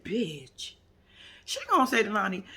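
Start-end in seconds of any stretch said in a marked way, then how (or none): 0.0-0.7 unvoiced under-breath whisper; whispered aside to the TV; whispered bitch. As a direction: hiss it under your breath. Muttered reaction to TV.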